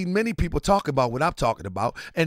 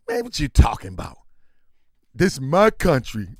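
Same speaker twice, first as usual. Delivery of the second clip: mock patriot; 7 semitones lower than usual. Mocking a loyal American voice.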